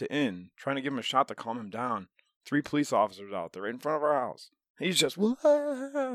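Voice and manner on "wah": high, louder mock wail; a hammed-up high-pitched screaming wah. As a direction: imitate the man's wild wail.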